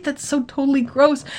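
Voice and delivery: ordinary delivery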